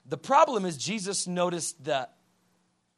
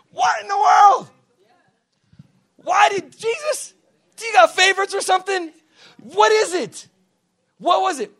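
high-pitched, shouted disbelief; mock outraged questioning; 15 dB louder than usual. Preacher demanding whether Jesus plays favourites, mock outrage. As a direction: demand an answer at the top of your voice.

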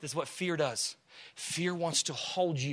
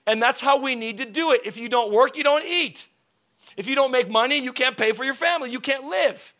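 rising, louder preacher's call; full-force preaching; 7 semitones higher, 10 dB louder than usual. Acted. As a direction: build the sermon to a loud peak.

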